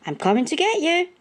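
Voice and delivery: high, fast eager call. A narrator's reading. Man calling up to George in the balloon, urgent high call. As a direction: shout up that help is on the way. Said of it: "he called".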